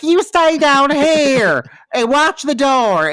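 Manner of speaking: high, drawn-out mock wail; hammed-up slow, high-pitched wailing command; Gretchen impression, wailing. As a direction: imitate a frantic order, stretched out and high. Mocking Gretchen's wailing order.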